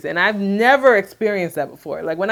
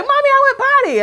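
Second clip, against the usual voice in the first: put-on very high, fast, louder toddler voice; proud toddler; 7 dB louder, faster than usual. Mother imitating her toddler announcing potty, squeaky high voice. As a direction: squeal it like a proud little kid. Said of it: "he'll go and be like".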